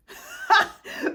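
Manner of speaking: real laugh that breaks out loudly. Real, not acted. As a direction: burst of high, loud laughter. Laughing at her own joke.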